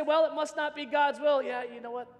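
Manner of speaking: ordinary delivery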